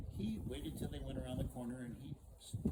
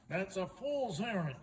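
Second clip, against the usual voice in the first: louder, higher guard dismissing him; scoffing guard; 6 semitones higher, 6 dB louder than usual. Acted. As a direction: scoff at the plan loudly.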